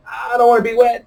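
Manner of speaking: high, whiny mock child voice; upset little daughter. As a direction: imitate a tearful six-year-old complaining. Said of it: "She is losing her mind because".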